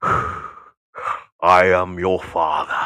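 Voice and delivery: hushed, deep Vader voice; Darth Vader. Acted. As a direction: intone it low and quiet like a movie villain. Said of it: "I sound like Luke".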